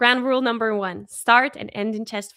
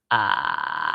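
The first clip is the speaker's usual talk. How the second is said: vocal fry. Shown as a demonstration. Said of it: "Now open that up to an ah sound".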